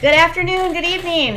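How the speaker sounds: high, loud bright greeting; cheery stream opener. Real, not acted. Streamer opening her show to viewers, cheery and high.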